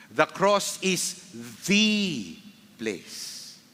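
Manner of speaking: "the" drawn out and set apart by pauses; stress on THE. Real, not acted. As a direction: hold "the" alone for weight. Preacher stresses the one place.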